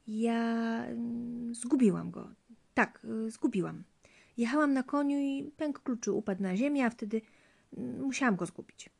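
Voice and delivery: high, hesitant, faltering excuse; nervous lying. A narrator's reading. Wife lies to her husband about the key, nervous faltering. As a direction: stall and fumble through a made-up story.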